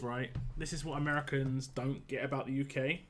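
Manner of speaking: normal talking voice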